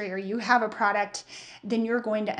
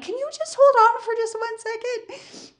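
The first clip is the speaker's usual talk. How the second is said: much higher, overly polite request to hold; mock sweet phone voice; 12 semitones higher, 4 dB louder than usual. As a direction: sound falsely calm while panicking. Retold asking caller to hold.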